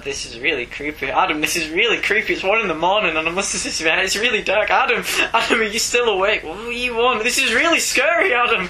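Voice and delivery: scared. Retold or imitated. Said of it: "And I said".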